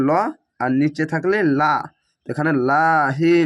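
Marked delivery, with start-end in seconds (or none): 2.7-3.3 লাহি slowly with long আ; lengthened vowel. Shown as a demonstration. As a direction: stretch লাহি one alif. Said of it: "খাড়া জবর এক আলিফ টেনে পড়বেন".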